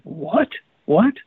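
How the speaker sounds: short, sharply rising question, twice; surprised. Retold or imitated. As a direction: imitate someone taken aback by the question. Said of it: "with a surprised look and said".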